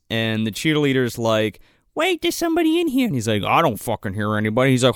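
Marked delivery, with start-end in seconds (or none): 2.0-3.0 high, quick girly imitation; mock cheerleader. Voices the cheerleader. 3.4-4.7 lightly voiced low dismissive dude; mock yokel boyfriend.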